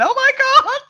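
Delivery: high, loud panicked scream; distant screaming. Retold or imitated. Retelling the screams heard mid-ritual, a high loud panicked scream. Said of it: "all I can hear in the background is screaming".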